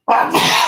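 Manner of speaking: sudden dry cough; short unvoiced cough into the mic. Real, not acted. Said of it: "Sorry, I don't have covid".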